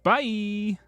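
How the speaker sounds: normal talking voice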